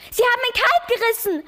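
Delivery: high, loud scream of a distraught mother; frantic high-pitched outcry. Acted. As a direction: scream it in horror over the dead calf. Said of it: "schrie seine Mutter".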